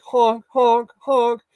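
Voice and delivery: high, loud goose honks; goose honk imitation. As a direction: honk like geese calling overhead. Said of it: "they're like".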